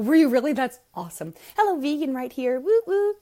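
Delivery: loud, high whoops of delight; excited cheer. Real, not acted. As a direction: cheer the viewer on. Cheering a viewer's activism.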